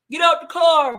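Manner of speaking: loud barked command; cop shout. Retold or imitated. Cops ordering them out of the car, loud shout. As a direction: bark it like a cop. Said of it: "two Caucasian cops".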